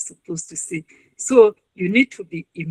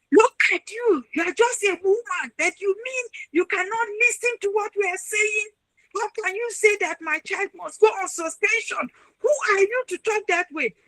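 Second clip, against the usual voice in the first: angry parent; 11 semitones higher than usual. Retold or imitated. Parent shouting at the registrar in the office, high and indignant. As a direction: berate the registrar as a furious parent.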